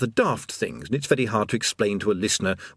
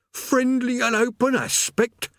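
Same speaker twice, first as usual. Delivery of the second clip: high, slow, scornful demon echo; squawked mock question, pitched way up; incredulous echo; slower than usual. A narrator's reading. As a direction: repeat the phrase in drawn-out disbelief. Demon repeats the phrase in disbelief.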